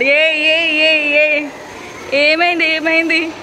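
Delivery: high, loud cooing to a little one. Real, not acted. Mother greeting her crying son at school, fussing loudly.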